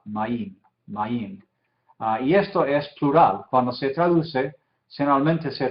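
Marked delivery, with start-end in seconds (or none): 0.1-1.4 maim said as a single syllable, soft and falling; one syllable. Shown as a demonstration. Teacher models maim as one syllable, quietly falling. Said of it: "esta es una sílaba".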